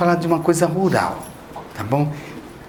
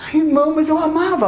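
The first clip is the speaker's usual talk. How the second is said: higher, plaintive voice of a believer justifying himself; whiny complaining believer; 9 semitones higher, faster than usual. Retold or imitated. Believer whining to the speaker about a lost love, high and plaintive. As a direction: whine it like someone who can't let go.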